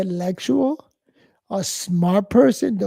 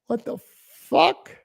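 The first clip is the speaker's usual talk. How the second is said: incredulous; 4 semitones higher, much slower than usual. Retold or imitated. Stares at the woman's partner.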